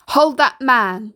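loud, drawn-out shouted command; yell. A narrator's reading. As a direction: yell it out slowly as an order. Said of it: "yelled the sheriff".

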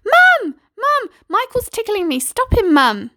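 high, loud shrieks for mum; shrieking girl. Acted. Girl shrieks to mum as Michael tickles her, high and loud. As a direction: scream for help while being tickled. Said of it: "The girl shrieked".

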